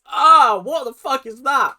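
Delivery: loud high shocked outburst; shocked exclamation. Retold or imitated. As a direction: recoil at a horrible sight. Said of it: "if you opened your eyes".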